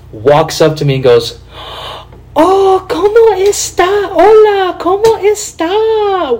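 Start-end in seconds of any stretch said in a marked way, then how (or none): 2.4-6.4 very high, drawn-out gushing greeting; slow syrupy squeal with bad Spanish.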